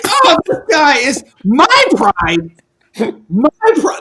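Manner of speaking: high, slow, laughing disbelief; incredulous at the nerve of it; mock indignant. Retelling his offended reaction to the host, high and amused.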